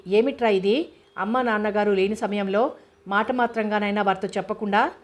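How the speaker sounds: plain reading voice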